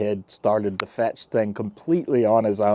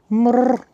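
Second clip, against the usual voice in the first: cat trill; 13 semitones higher, 5 dB louder than usual. Acted. Purrs at the cat.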